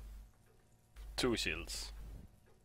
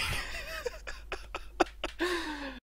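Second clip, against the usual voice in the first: nervous laugh; 5 dB quieter than usual. Real, not acted. Laughter after the scare.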